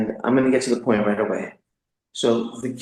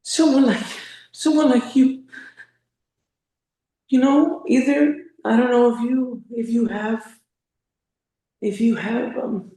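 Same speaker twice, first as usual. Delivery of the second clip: high, tight voice on the edge of tears; choked up and barely holding it together; getting emotional; 12 semitones higher than usual. Real, not acted. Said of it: "I'm getting a little emotional here".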